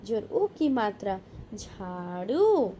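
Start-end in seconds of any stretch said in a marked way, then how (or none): 1.5-2.7 slow blended word. Shown as a demonstration. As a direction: stretch each syllable slowly. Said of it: "spelling drill, slow blended word".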